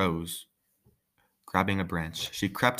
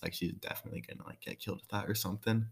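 1 whispered aside; 9 dB quieter, faster than usual. Real, not acted. Aside about suspicious knife.

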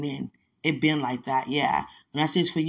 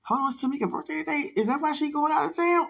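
higher, quicker, mock panicked question; alarmed realization; 9 semitones higher, faster than usual. Retelling doubting her mother about the birthday, alarmed. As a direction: rush it as the doubt sets in. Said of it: "it started sounding so convincing".

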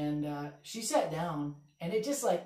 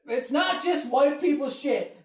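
slow, high, loud, laugh-crying it out; laughing retort; 8 semitones higher, 8 dB louder, slower than usual. Retold or imitated. Comic answering the heckler, laughing loud retort. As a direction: wail it through laughter. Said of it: "I go".

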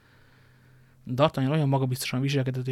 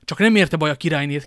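alarmed exclamation, louder; erschrockener Ausruf possibly; 2 semitones higher, 7 dB louder than usual. A narrator's reading. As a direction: cry out the anxious question. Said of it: "kiáltott fel Buckingham".

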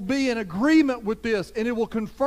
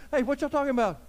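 casual Jesus; 6 semitones higher, faster than usual. Retold or imitated. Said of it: "Jesus shows up and says".

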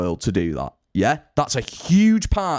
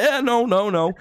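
high, mock-nervous group denial; 8 semitones higher than usual. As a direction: hurry to deny it in a lifted, guilty voice. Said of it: "we were all kind of like".

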